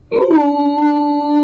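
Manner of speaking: loud drawn-out moo; cow moo. Acted. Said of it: "and he said".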